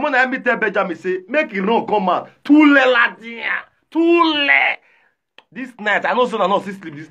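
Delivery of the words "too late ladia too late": higher, louder, slow mock lament; mock wail. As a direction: moan it like a doomed man. Mock lament in retold scene.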